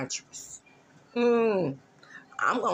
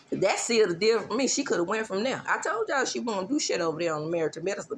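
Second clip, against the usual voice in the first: normal talking voice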